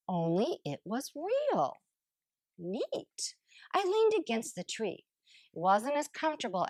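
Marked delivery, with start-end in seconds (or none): 2.6-3.4 whispered neat. Acted. Quiet wonder at the tree.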